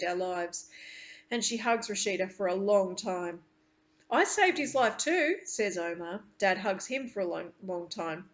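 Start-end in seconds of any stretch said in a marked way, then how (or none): 4.1-5.4 lightly voiced boy, a bit higher and louder; a hint of a proud little boy claiming credit; boyish pride. Child Omar speaks up proudly.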